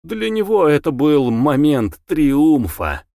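ordinary delivery

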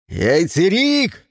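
slow drawn-out yell. A narrator's reading. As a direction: bellow the name slowly. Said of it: "заорал Джон".

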